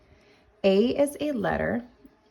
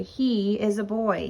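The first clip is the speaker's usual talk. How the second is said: normal talking voice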